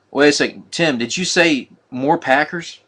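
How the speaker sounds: ordinary talk, nothing marked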